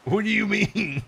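giggling through the question; can barely ask it for laughing. Real, not acted.

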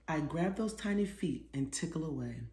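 plain reading voice

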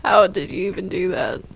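usual speaking voice

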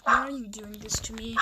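ordinary talk

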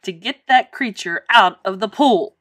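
loud, emphatic order; flustered coach's loud demand; upset cry. A narrator's reading. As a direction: punch it out as an ultimatum. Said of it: "he cried".

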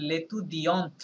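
লেতুদিয়ন্ট ending in an audible T; sounded final T. Shown as a demonstration. Teacher shows the feminine form, pronounced up to T. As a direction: say লেতুদিয়ন্ট right through to the T. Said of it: "টি পর্যন্ত উচ্চারণ করতে হবে".

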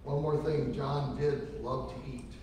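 normal talking voice

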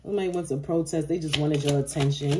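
ordinary talk

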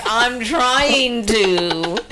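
very slow, high, stretched-out whine; strained whine. Retold or imitated. Dangling kid answering mom, slow strained high whine. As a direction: drag it out like you're straining to hold on.

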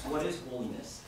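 ordinary delivery

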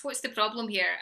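ordinary talk

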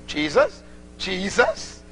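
low-pitched, disbelieving question; low mock disbelief, rising at the end; incredulous echo. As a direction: repeat it as a baffled, put-off stranger. Acting stranger's incredulous reaction to question.

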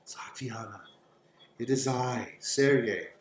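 hushed, near-whispered call; ghostly lover's voice. Acted. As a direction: let the name drift out in a soft breath. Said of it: "you hear a voice that says".

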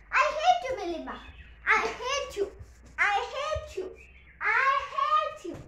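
high, loud pretend "I hate you"; hammed-up fury at the dog; mock angry shout. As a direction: fake rage to test the dog.

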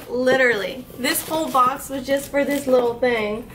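normal talking voice

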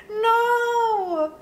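high dismayed "No!"; dismayed cry. Real, not acted. Crushes the pearls by accident.

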